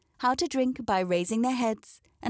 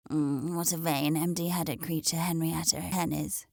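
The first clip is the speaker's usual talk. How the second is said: put-on spluttering old dame; 3 semitones lower than usual. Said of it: "she exclaimed".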